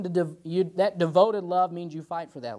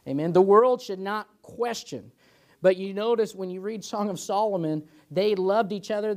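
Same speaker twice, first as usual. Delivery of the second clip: rising preaching; 6 semitones higher than usual. Acted. Preacher rising to full force.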